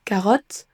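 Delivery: "carotte" the French way, full o vowel; spelling pronunciation. Shown as a demonstration. Teacher shows French learners' mistake, French-style carotte. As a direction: read "carotte" as spelt, in French sounds. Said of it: "tendance à remplacer le son schwa".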